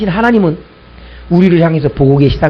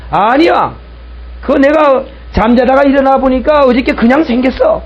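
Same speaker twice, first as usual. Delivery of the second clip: higher-pitched argumentative protest; raised-pitch imitation of a denial; insistent retort; 7 semitones higher than usual. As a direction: act out someone stubbornly insisting in a quarrel. Acted quarrel, atheist told back.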